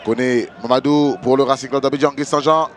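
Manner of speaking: urgent live play-by-play, loud; french sports commentary. Real, not acted. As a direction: call the attack as it unfolds. Live play-by-play building to goal.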